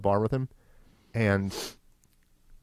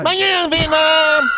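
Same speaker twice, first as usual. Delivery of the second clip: high, loud Vietnamese audiobook parody; shrieked mock-Vietnamese narration; mock Vietnamese accent; 9 dB louder, slower than usual. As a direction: shout slowly in caricatured Vietnamese. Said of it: "some Vietnamese books on tape".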